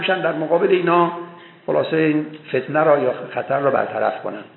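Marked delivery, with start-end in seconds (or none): none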